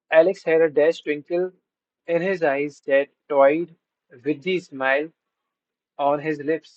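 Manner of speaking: slow, careful read-aloud of an exam sentence; slow question reading. Shown as a demonstration. Teacher reading an exam sentence to students, slow and deliberate. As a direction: spell it out word by word for the class.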